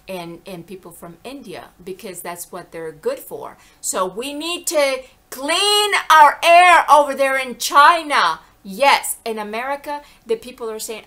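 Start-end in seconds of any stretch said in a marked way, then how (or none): 3.8-9.2 very loud, high, slow proclamation; emphatic declaration. Real, not acted. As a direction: holler it out word by word. Emphatic declaration about China's air.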